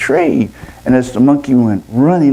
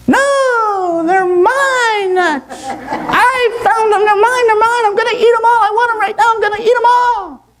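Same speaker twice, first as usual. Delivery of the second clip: very high squealing tantrum; possessive monkey. Acted. Monkey refuses to share with the owl, possessive tantrum.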